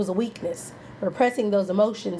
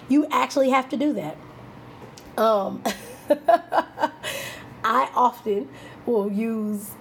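ordinary talk

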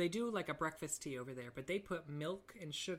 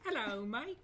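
high, loud mock British greeting; British accent; 8 semitones higher, 5 dB louder than usual. Shown as a demonstration. Co-host copying the British attempt, high mock British accent. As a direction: echo a badly faked British hello. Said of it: "do British".

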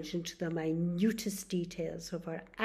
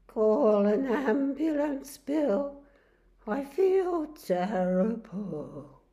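louder, slow pleading for help; sick man pleading; 5 semitones higher, 10 dB louder, slower than usual. Acted. Charlie begs his neighbour to call an ambulance, slow and ill. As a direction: drag the words out like a man feeling ill.

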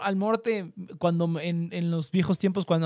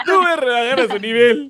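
laughing refusal; 5 semitones higher, 7 dB louder than usual. Real, not acted. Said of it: "refusing with laughter".

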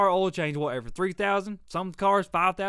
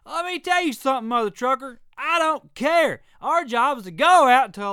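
much higher, louder, defiant rant; 7 semitones higher, 8 dB louder than usual. Real, not acted. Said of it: "I'm ranting today".

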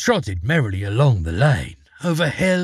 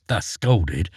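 usual speaking voice

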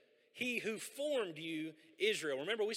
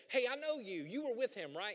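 lightly voiced girl, pitched higher, pointing him out; slave girl accusing; 7 semitones higher, 4 dB louder than usual. Slave girl in the courtyard accusing Peter, lightly voiced higher. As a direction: call him out, half teasing.

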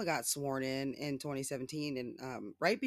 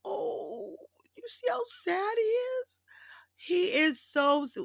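very high cooing mock sympathy; mock pity; slower than usual. Real, not acted. Taunting Trump to her viewers in a very high coo.

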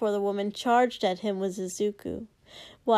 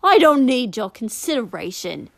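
angry retort; 2 semitones higher, 8 dB louder than usual. Acted. Warrior snaps back angrily.

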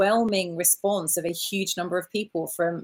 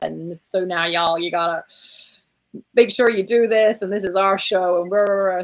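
loose imitation of an American accent, pushing louder; retold American accent announcer, a bit louder; mock American accent; 5 dB louder than usual. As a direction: hype the crowd in a put-on American accent. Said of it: "did this fabulous U S accent as a voiceover".

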